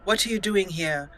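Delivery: ordinary talk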